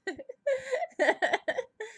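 high bright burst of giggling; high giggle. Acted. Reacts to own game.

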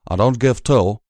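mumbled through a muzzle; muffled mumble. A narrator's reading. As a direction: talk around something in your mouth. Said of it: "his voice muffled and slightly mumbling".

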